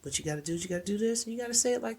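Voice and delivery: quick, hushed insider advice; coaching voice. Retold or imitated. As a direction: rattle off the plan like a fixer. Best friend coaching her what to say.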